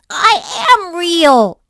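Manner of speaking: high, loud, slow call; a hurt toy calling out; calling after them. Acted. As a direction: call it after the rabbits as they go. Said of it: "called after them".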